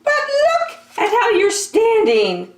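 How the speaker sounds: very high, fast, chirpy question; a tiny bird piping up; squeaky bird voice. Acted. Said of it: "Marabou said".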